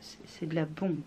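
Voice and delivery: ordinary talk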